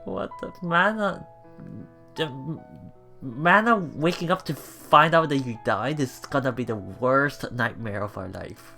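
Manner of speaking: ordinary talk, nothing marked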